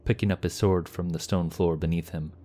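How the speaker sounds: usual speaking voice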